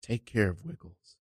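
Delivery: very soft, grieving request; hushed farewell. Acted. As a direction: entrust a dead pet, barely audible. Acted mourning of killed kitten.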